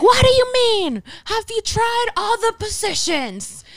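very high, screechy-pitched heckler imitation; exaggerated high voice, incredulous; mock yelling heckler. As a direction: mimic the heckler's outraged questioning. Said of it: "She yelled out, like".

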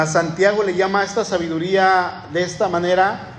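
normal talking voice

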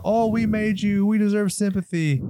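mock the rant in a high voice; mocking whiny rant. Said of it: "does the same goddamn rant".

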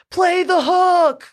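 high, long-drawn mock yell; imitated heckling yell. Drunk fans heckling the band, high drawn-out mock yell. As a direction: stretch every word like a drunk heckler. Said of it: "they just keep yelling".

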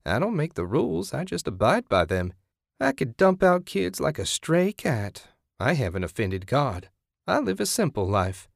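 high, swooping sing-song sermonizing; sanctimonious lilt leaping up and down; self-righteous zealot. Acted. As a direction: play a smug, pious woman defending herself.

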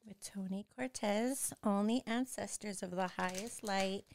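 slow, soft spiritual calling to the ancestors; solemn invocation. Acted. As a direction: call the spirits in gently and slowly, like a prayer.

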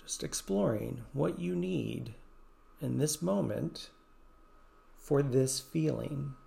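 soothing guided meditation voice. Acted. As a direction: ease the listener through each breath.

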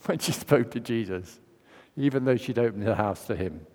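normal talking voice

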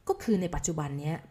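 ordinary talk

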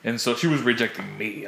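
ordinary talk

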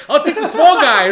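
loud, high comic battle call; laughing mock bravado. Friend retelling his fight plan, laughing mock bravado. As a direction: shout it through laughter.

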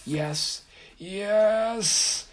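triumphant cackling glee; gleeful. A narrator's reading. Haint gloats as box opens.

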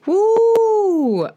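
loud high excited cheer; cheering woo. Real, not acted. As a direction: whoop it out with joy. Cheering for a favourite film.

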